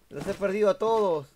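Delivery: high, loud wail of loss; loud lament. Real, not acted. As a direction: cry out over losing the whole team. Lost all his teammates.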